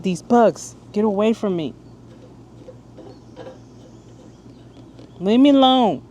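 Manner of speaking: high, loud shoo at bugs; yelling at bugs. Real, not acted. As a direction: shout at the bugs to leave.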